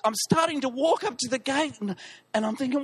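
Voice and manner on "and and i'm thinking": ordinary talk, nothing marked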